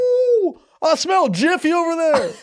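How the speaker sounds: very high, slow yappy dog; excited dog voice. Retold or imitated. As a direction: yelp it like a thrilled pup.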